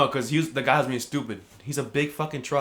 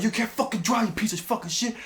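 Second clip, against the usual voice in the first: high-pitched imitation of an angry yell; yelling insults; 10 semitones higher than usual. Dad yelling at the guy blocking the truck, angry. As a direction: mimic a hothead berating a driver. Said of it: "He's, like, yelling at the guy".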